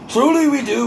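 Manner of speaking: sudden high, emphatic repeat. Shown as a demonstration. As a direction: jump way up and insist. Repeats line much higher, louder.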